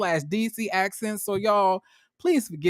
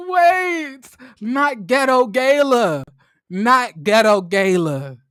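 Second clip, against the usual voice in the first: slow, louder, drawn-out correction; mock protest; 4 semitones higher, 5 dB louder, much slower than usual. Host reacting to Winter's line, slow mock protest. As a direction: draw out each word in disbelief. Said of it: "I was like".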